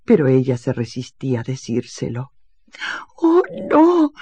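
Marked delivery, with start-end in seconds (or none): 3.2-4.1 high, louder sobbing cry. Acted. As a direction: beg through mock sobs. (sollozo agudo)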